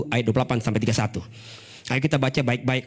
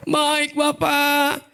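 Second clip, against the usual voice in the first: higher, very slow syrupy "Baik Bapak"; sweet yes; 11 semitones higher, much slower than usual. Retold or imitated. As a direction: drawl it out, fake sweet. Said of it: "kayak kita tuh".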